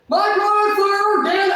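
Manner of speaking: high, very loud imitation yell; mock full-volume screamed announcement; screaming. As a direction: bellow the boast in someone's ear. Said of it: "if you don't stop screaming".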